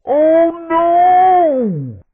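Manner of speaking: high, drawn-out cry of horror; dismayed wail. Acted. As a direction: wail it long in cartoon despair. Pilot cries out in disaster.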